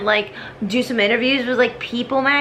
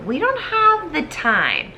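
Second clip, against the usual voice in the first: rising mock plea; 10 semitones higher than usual. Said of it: "So Hamilton's just like".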